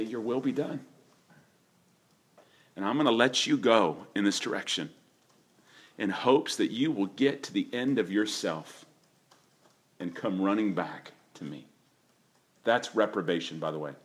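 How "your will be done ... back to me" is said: softer, sorrowful father letting go; grieving father. Retold or imitated. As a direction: let him go gently, heartbroken. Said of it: "But you say".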